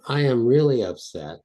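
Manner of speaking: emphatic demonstration of being upset; heightened insistence. Shown as a demonstration. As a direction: lean hard on "really". Escalating acted upset in exercise.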